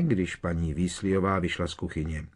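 ordinary delivery

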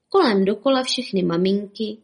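plain reading voice

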